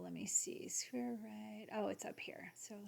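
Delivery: whispered to herself while searching; hushed self-talk while hunting for a setting. Real, not acted. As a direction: murmur it under your breath.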